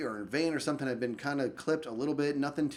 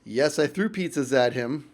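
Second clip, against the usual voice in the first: normal talking voice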